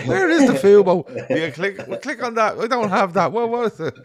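high and loud, flustered; confused mum voice. Retold or imitated.

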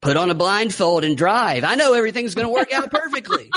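high, mock-confident parody; mocking exaggeration. Mockingly extending the clip speaker's claim.

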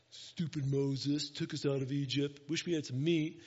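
low, grumbling mock complaint; whining complaint. People grumbling around Moses about food, mock whining. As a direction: imitate a grumbling crowd, lightly whiny. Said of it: "all this whining going on and complaining".

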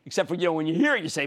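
ordinary talk, nothing marked

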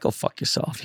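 under-the-breath whisper; whispered curse. Retold or imitated. Annoyed coach muttering a whispered curse. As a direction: hiss it softly, grumbling. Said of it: "He's like".

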